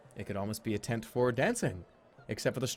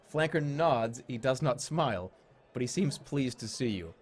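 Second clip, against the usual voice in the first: ordinary talk, nothing marked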